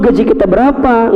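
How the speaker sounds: normal talking voice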